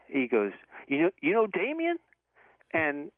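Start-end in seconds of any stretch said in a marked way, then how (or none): 0.9-2.0 high, rising Southern question; Southern accent. Retold or imitated. Stranger on the phone asking about Damien, imitated Southern accent. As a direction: imitate a puzzled Southern good old boy. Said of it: "like, a thick Southern accent".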